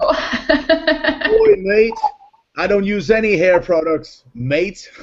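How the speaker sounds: high-pitched, put-on New Zealand accent. Host answering about hair products, mock New Zealand accent. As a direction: have a playful go at sounding like a Kiwi. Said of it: "New Zealand accent".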